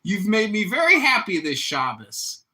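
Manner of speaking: high, loud mock dad voice; imitating his dad. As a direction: ham up the proud father, loud and high. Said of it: "He'll be like".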